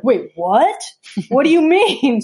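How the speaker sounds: rising, half-laughing what?; incredulous teen, voice jumping up; shocked disbelief. Retold or imitated. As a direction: play the kid caught off guard. Nephew shocked by mom's threat.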